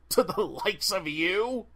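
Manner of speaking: slow, mocking question, TO rising, a laugh held in; holding back laughter. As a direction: barely keep from laughing at her. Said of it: "trying to hold back the laughter".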